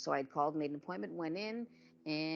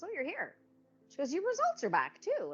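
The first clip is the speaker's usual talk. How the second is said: higher, brightly imitated receptionist; chirpy receptionist; 13 semitones higher, 6 dB louder than usual. Receptionist telling the patient her results are back, high and perky. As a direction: mimic her overly cheery news.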